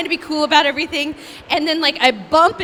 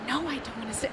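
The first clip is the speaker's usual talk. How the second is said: much softer, deflated refusal; mock reluctant mumble; 14 dB quieter, much faster than usual. Castmates refusing to sing, soft sulky mumble. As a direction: mumble it like you dread it. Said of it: "everyone's like".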